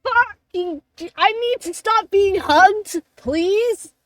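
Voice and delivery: high, loud begging protest; hammed-up shout of exasperated pain; pained yell. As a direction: yell it, pleading to be let go. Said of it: "he's gonna yell again".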